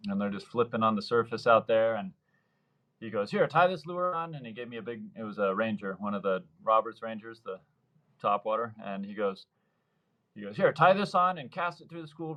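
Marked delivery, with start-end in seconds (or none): 3.3-4.3 eager buddy. Retold or imitated. Buddy handing him a lure. 10.6-12.3 eager buddy. Retold or imitated. Buddy's instructions.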